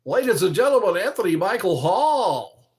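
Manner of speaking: high, loud announcer voice; game show host. Acted. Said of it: "Sounds like a game show host".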